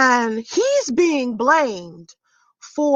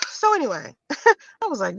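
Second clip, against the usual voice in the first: ordinary talk, nothing marked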